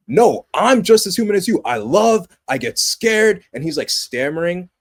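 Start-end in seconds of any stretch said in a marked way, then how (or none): 0.1-3.4 higher and louder, heartfelt confession; earnest Superman. Retold or imitated. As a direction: plead your humanity openly. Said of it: "he's telling him".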